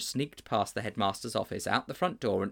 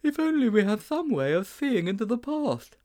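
put-on high boyish character voice, well above narration; high-voiced storybook boy; Sponge's wistful voice; 12 semitones higher, 4 dB louder than usual. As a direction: pitch it high for an eager schoolboy.

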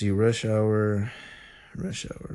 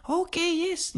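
very high, softer mimicry; waitress impression; 5 dB quieter, slower than usual. Waitress accepting the Sprite order, high mimicking voice. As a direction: answer as the obliging waitress, high-pitched. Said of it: "That's how they talk".

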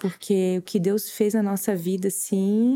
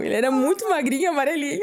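laughing while talking; 8 semitones higher, faster than usual. Real, not acted. Laughing at tiny yellow baby.

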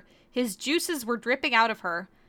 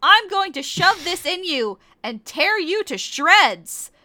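louder, higher, slow mock menace; threat; 7 semitones higher, 6 dB louder than usual. Korra threatening Kagome, slow hammed-up fury. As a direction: lean into every word of the threat.

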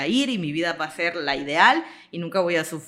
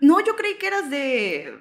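surprised stranger; 8 semitones higher, 4 dB louder, slower than usual. Retold or imitated. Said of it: "siempre me dicen".

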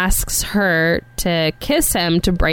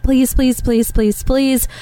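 quick string of higher-pitched begging; pleading; 5 semitones higher than usual. Acted. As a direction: imitate a girl wishing hard. Child voiced wishing on a star.

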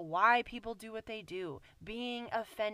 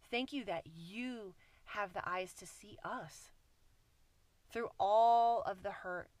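prayer; 4 semitones lower than usual. Acted. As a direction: pray gently and sincerely to the Father. Closing prayer.

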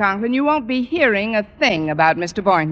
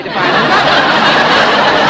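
laughing; 2 semitones higher, 9 dB louder, slower than usual. Acted. Laughing while talking to Conklin.

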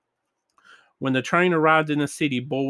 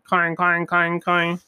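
even, higher-pitched clanging; imitated alarm bell; 4 semitones higher, slower than usual. A narrator's reading. Reader imitates the firehouse alarm, ringing clangs. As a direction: ring it out like an alarm. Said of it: "began to ring".

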